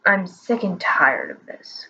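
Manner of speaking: low, slow grumble; grumbling old man. A narrator's reading. Old wizard complaining to his bird, low slow grumble. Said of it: "grumbled the old man".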